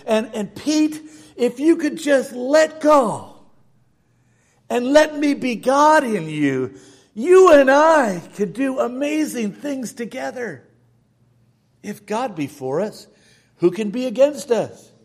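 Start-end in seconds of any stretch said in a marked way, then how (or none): 0.1-10.7 loud, high, urgent appeal; rising preaching. Acted. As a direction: plead with the congregation. Preaching at full force.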